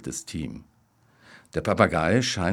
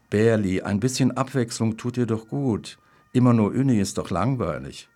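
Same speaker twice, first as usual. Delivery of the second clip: higher and louder, cooing wife calling him Berli; the wife's voice; 5 semitones higher, 4 dB louder than usual. Retold or imitated. Wife gently chides her husband on the phone, higher sing-song. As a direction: mimic his wife's sweet scolding. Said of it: "Dann wird sie wieder sagen".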